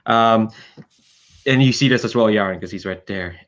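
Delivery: ordinary talk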